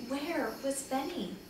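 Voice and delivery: plain reading voice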